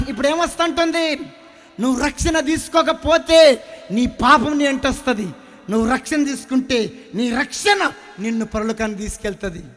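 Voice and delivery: loud fiery sermon warning; preaching at full force. Acted. Preacher warns the congregation about sin: loud fiery preaching.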